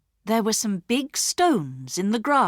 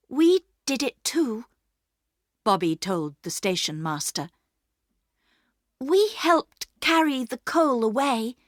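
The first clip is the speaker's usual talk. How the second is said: put-on higher, earnest girl owning up; Bobby voiced; 7 semitones higher than usual. A narrator's reading. Bobby telling the station master they helped, higher and earnest. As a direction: stand by your brother.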